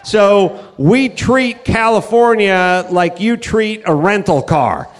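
ordinary delivery

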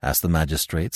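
plain reading voice

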